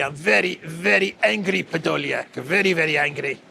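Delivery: hammed-up grumble of a mild tantrum; mock angry Zelensky. President complaining to his aide Podolyak, a touch of mock anger. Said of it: "bitter, angry Zelensky".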